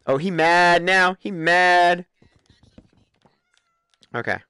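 normal talking voice

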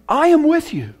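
high, ringing declaration; proclaimed. Acted. Preacher declaring God's words to believers, high and ringing. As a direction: announce the promise to the whole hall.

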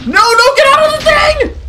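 very high, loud shriek; frantic screaming. Real, not acted. Player trapped in chest menu, frantic scream. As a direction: scream desperately to break free. Stuck in chest, about to die.

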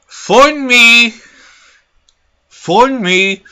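high, loud whiny mimicry of him; mocking quote. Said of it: "this motherfucker said".

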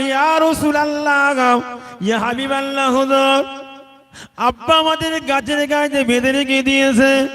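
chanted lament, high at first then settling; imitated tearful children. Tied children explain to the Prophet, chanted tearful lament. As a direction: intone a child's plaintive explanation melodically. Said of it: "চোখের পানি রোনাজারি করে বলে".

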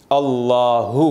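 अल्लाहु with the हु breathed from the throat; correct final ha. Shown as a demonstration. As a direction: draw out अल्लाहु, voicing हु clearly. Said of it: "अल्लाहु नहीं". (सही अल्लाहु, हु गले से)

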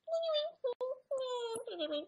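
squealing helpless laugh; high-pitched laughing fit. Real, not acted. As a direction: laugh so hard it goes high and breathless. Cracking up over the video.

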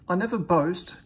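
plain reading voice

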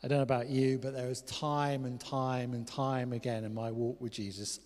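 normal talking voice

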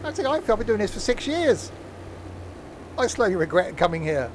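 high-pitched, hammed-up fed-up viewer; mock exasperation. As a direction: complain like a weary regular, in jest. Voicing a regretful long-time viewer.

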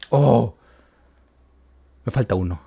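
normal talking voice

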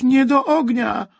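high, loud, drawn-out scream of protest; desperate cry. A narrator's reading. Boy pleads again as soldier nears the fire, desperate slow shout. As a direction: wail it slowly at the top of a child's voice. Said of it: "krzyknął rozpaczliwie Wojtuś".

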